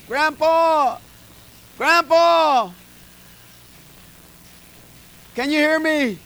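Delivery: high, loud calls into the phone; wife screaming. Retold or imitated. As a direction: shout to a deaf grandpa. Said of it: "my wife would be screaming".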